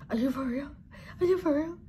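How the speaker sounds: high-pitched mock disbelief, rising on the repeat; imitated disbelief. Husband shown the test, in imitated disbelief. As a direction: play a stunned husband doubting it.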